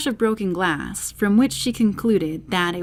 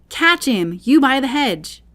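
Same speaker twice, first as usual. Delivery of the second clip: louder, higher shout; rabbit shouting; 6 semitones higher, 5 dB louder than usual. A narrator's reading. The rabbit shouting orders to the hedge, loud. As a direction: yell an order across the garden. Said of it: "the rabbit's voice alone".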